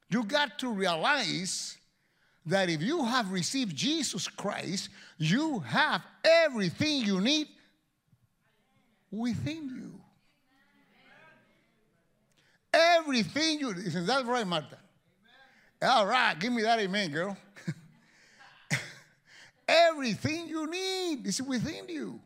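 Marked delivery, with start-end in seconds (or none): 0.1-13.7 much higher and louder, building on everything; preaching at full force. Acted. Preaching climax on everything within. 19.7-22.2 much higher and louder, building on everything; preaching at full force. Acted. Preaching climax on everything within.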